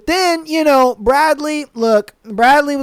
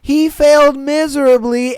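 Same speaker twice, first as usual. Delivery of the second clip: ordinary talk, nothing marked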